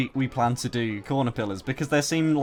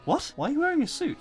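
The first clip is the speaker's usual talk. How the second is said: surprised; 12 semitones higher than usual. Real, not acted. Shocked at suit revelation.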